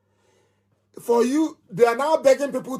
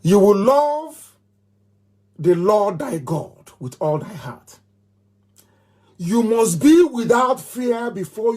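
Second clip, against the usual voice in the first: slow, drawn-out, raised oath delivery; solemn creed recital; slower than usual. Acted. Leader recites creed to followers, slow and solemn. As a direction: intone it like a sacred vow.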